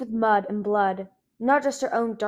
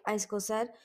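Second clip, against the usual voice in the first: ordinary delivery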